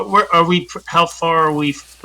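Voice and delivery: ordinary delivery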